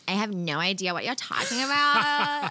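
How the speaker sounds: lightly put-on Valley Girl talk; Valley Girl accent. Guest plays dumb about the game's fact, mock Valley Girl.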